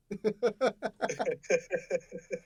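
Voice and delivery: long rippling fit of giggles; drawn-out amused laugh; real laughter. Real, not acted. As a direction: keep chuckling helplessly at the joke. Cracking up at the accent joke.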